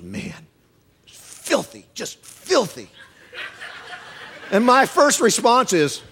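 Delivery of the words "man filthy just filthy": breathy, amazed "filthy" through a laugh; mock awed slang. Guy praising sermon.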